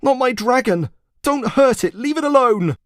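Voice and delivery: high, loud desperate plea; pleading shout. A narrator's reading. Boy pleading with villagers, high and loud. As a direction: beg them to stop. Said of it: "called the boy".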